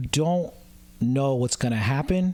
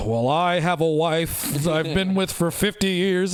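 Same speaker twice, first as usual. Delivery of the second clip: prim married guy. Retold or imitated. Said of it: "he's like".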